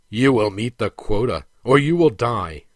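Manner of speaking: grip his throat and repeat the threat; menacing threat. A narrator's reading. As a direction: overseer threatening the choking prince. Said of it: "repeated Khe Sanh".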